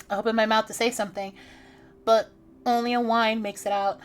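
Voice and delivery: ordinary talk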